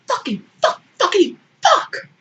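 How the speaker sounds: high, loud burst of swearing; Baring bursting in cursing, high and loud. Acted. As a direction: explode into a squeaky string of curses.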